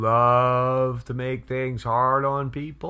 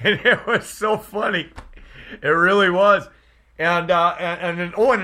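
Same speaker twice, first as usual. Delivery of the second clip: laughing; 5 semitones higher, 5 dB louder than usual. Real, not acted. Laughing at a funny video.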